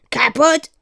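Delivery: a light squawk, like a bird; lightly voiced raven croak. A narrator's reading. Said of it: "krächzt Cedric".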